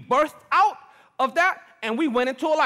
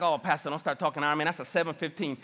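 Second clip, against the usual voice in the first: low, soft, quick imitated grumble; mock congregant complaint; 8 semitones lower, 6 dB quieter, faster than usual. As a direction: lightly imitate a churchgoer objecting under the breath.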